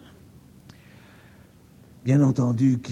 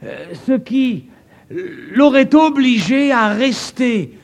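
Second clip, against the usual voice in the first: higher, louder, rising preaching mockery; preaching build; 10 semitones higher, 7 dB louder than usual. Preacher mocking evolution theory before the crowd, rising loud preaching.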